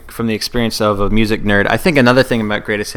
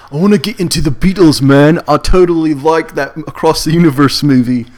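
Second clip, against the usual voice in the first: put-on higher, eager teen dude voice; teenager voice; 4 semitones higher, 5 dB louder than usual. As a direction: play a naive teenage fan. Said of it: "So I'll start with the big one".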